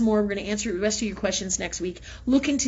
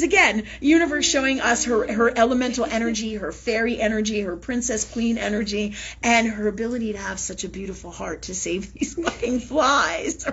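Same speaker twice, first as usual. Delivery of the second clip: ordinary talk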